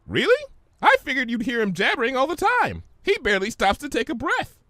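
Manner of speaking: very high, giddy sing-song; bubbly Pinkie voice. Acted. Pinkie playing with words at the market, high and giddy. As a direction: bounce on every syllable.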